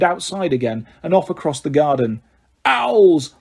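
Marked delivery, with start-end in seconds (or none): 2.6-3.3 loud, higher angry bellow. Acted. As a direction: roar the word in fury. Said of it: "bellowed Uncle Vernon".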